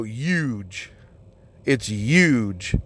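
"huge" said "yooj", no H; H dropped. Retold or imitated. Complaining about people who skip the H, "huge" said as "yooj". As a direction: drop the H and drag out "huge". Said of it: "but without the H".

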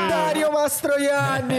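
high, slow ring-announcer call; announcer intro shout. Pretend. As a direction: stretch the name out for the crowd. (presentazione urlata da annunciatore)

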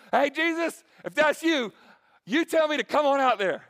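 loud, high casual holler; Peter hollering. Retold or imitated. Peter hollers to Jesus from the boat, loud and high. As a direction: yell it out over the water.

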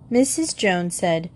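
ordinary delivery

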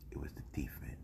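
breathy hushed whisper. Acted. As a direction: lean in and whisper it like a secret. Quiet build before the punchline.

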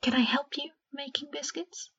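soft, whispered hopeful question; a shy girl asks the elf to bake together, whispered; whispered request. Acted. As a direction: ask it under your breath, hoping.

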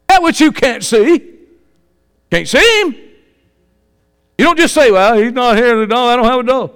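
loud, high-pitched sermon delivery; full-force preaching. Real, not acted. Preacher tells an unbeliever he is bold and confident, full-force preaching. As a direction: drive it home loudly to the doubters.

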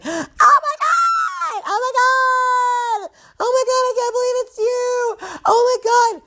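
piercing high squeal, words stretched; screaming greeting. Acted. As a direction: shriek like you've spotted a long-lost friend. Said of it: "just scream and yell".

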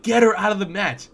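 retold yell at the TV; screaming. Apartment shouting at the match, retold scream.

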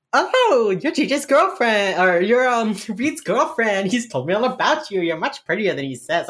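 gushing mom mode. Retold or imitated. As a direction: gush like a proud mom embarrassing her son. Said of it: "she would have gone into mom mode".

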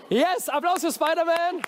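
high-pitched, excited call for applause; cheering. Real, not acted. Host thanking Spider-Man after the movements, cheering. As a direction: whip up the crowd's applause.

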